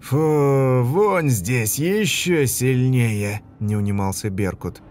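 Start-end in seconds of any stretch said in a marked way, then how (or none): 0.0-3.4 disgusted. Acted. Soldier disgusted by stench.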